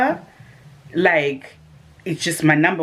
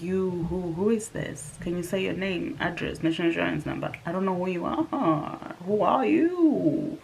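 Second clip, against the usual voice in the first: playing dumb on the phone, softer; feigned ignorance, softer; 7 dB quieter than usual. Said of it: "trying to act like you don't know".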